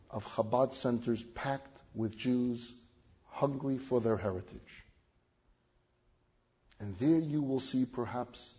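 ordinary delivery

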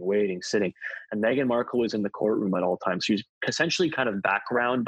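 ordinary talk, nothing marked

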